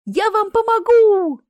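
high, loud, stretched-out cheer; joyful yell. A narrator's reading. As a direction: cry it out with delight. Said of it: "радостно завопила Марина".

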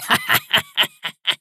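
high, staccato madman's laughter; creepy crazed laugh. A narrator's reading. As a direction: burst into an eerie, mad cackle. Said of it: "старик зашёлся в жутком смехе". (жуткий смех сумасшедшего)

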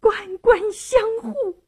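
high-pitched indignant woman's outburst; tearful indignation, pitched far up; bitter curse. A narrator's reading. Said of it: "骂了声".

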